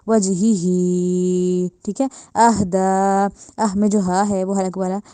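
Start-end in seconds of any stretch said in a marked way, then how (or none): 0.1-1.7 वजही ही with the last ही drawn long; lengthened recitation. Shown as a demonstration. Teacher recites with long madd for students, very slow. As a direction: stretch the final ही in वजही ही. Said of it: "ज़्यादा लंबा किया".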